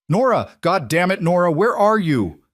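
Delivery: frantic calling out into the storm; urgent shouting. A narrator's reading. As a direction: shout her name desperately from afar. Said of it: "one of the voices was calling her name with an urgency".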